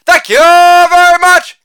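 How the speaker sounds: hammed-up stage thank-you; showman sign-off. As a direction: stretch out the thanks like a showman taking a bow.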